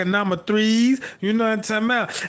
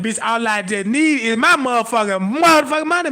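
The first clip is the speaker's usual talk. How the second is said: loud demand for money; 4 dB louder than usual. Acted. Demanding his money, building to a shout.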